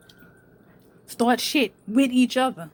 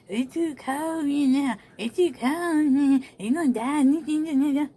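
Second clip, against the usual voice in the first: slow, whiny, higher mock complaint; mocking whine; 4 semitones higher, slower than usual. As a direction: drag it out like a fussy excuse. Mocking someone's excuses in a rant.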